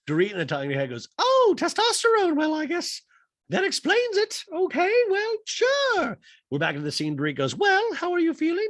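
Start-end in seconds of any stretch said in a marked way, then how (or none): 1.2-6.2 loud, high falsetto; mock housewife in a high put-on voice; Dorit impression voice. 7.6-8.7 high, loud falsetto question; mock housewife falsetto; Dorit impression voice.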